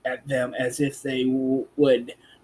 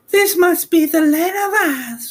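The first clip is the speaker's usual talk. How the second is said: put-on high young girl voice, curious; Dorothy's girl voice; 6 dB louder than usual. Girl asking about Oz, high and curious. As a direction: voice the girl much higher.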